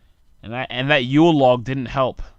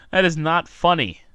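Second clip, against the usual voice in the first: ordinary talk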